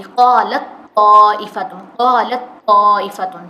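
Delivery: قالت طائفۃ slow and loud, the ت swallowed into a heavy doubled ط; ta merged into toe. Shown as a demonstration. Teacher showing students the merged قالت طائفۃ. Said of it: "طا کی sound آئے گی".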